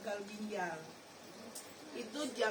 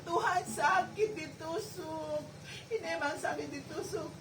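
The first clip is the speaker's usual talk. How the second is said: crying in pain; 5 semitones higher, 5 dB louder than usual. Retold or imitated. Patient crying to God about the painful needle. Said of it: "Saya nangis aja sama Tuhan".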